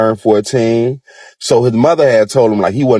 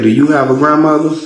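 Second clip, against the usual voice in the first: a lightly voiced old lady, pitched higher; a hint of a grandmotherly voice, higher; old lady asking; 8 semitones higher than usual. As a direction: ask it as a gentle challenge from an old woman. Old lady questions the boy.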